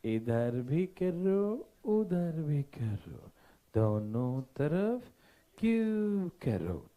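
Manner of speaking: very slow, drawn-out instruction; slow dictation. Real, not acted. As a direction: stretch each word out for the class. Spells out the step for class. (खींच-खींचकर, धीरे-धीरे समझाते हुए)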